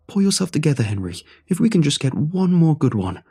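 loud, high heated retort; arguing. Acted. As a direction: snap back in the quarrel. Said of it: "Henry and the man arguing".

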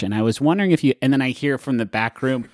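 usual speaking voice

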